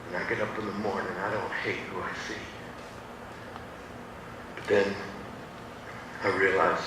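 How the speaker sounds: the dream fades to a near whisper; very quiet. Real, not acted. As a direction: let it fall to near silence. Fading quiet realisation.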